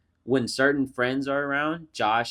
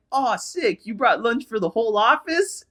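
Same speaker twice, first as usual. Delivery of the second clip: mock coworker taunt; 10 semitones higher, 4 dB louder than usual.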